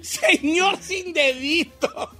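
slow giggly repeat; laughing slowly. Real, not acted. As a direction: draw it out through laughter. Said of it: "¿Por qué me da tanta risa?".

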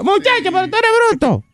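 very high mock grandma screech; exasperated grandmother. Host imagines grandma calling the boy stupid, high mock scolding. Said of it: "Es que la abuela le diga".

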